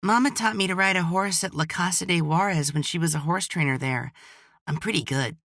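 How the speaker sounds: put-on high little boy stating facts; a kid bragging matter-of-factly; matter-of-fact child. A narrator's reading. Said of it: "he said in a matter-of-fact tone".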